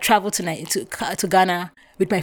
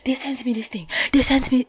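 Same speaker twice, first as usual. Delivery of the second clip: retold crying; 5 semitones higher than usual. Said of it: "the way I was crying and talking and screaming and yelling".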